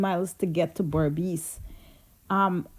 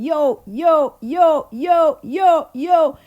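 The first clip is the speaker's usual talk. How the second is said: loud, high farmyard call to pigs; 6 semitones higher, 6 dB louder, much slower than usual. Retold or imitated. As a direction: grandmother hollering the pigs home. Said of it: "she's calling the pigs in".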